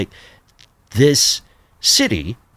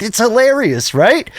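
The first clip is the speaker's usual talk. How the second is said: high, rising, mock delight; retold amused wife; 12 semitones higher, 4 dB louder than usual. Wife enjoying her husband's blunder, imitated high. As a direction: imitate someone tickled by it, pitched way up. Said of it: "She's like".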